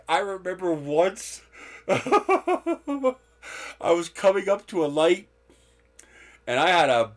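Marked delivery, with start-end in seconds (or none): 0.1-3.2 laughing while talking. Real, not acted. Laughing at her own story.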